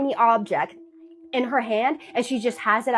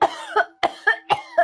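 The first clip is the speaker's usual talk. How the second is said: choking cough. Retold or imitated. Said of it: "gasp for breath".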